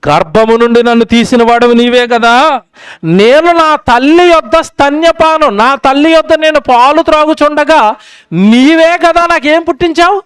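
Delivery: high, slow, ringing psalm recitation; scripture reading. Acted. Preacher reading Psalm 22:9 aloud, slow and lifted. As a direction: declaim the verse to the congregation.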